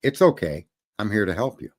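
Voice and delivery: calm it down, almost intimate; low, soft reassurance. Shown as a demonstration. Host demonstrating the phrase to the panel, softly reassuring. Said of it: "listen to me".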